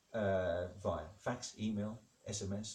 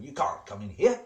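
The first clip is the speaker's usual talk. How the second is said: louder indignant vicar, rising at the end; indignant refusal; 2 semitones higher, 8 dB louder than usual. Acted. Vicar to Higgs boson at church, indignant refusal. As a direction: turn them away in mock indignation.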